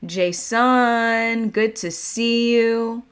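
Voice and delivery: loud, high, slowly held hello; calling out a greeting. Real, not acted. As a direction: call out to an arriving viewer, stretching the words. Greeting viewers as they join.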